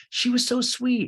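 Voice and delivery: put-on very high, loud, sugary girlfriend; Allie. Girlfriend praising the ex, mock sweet.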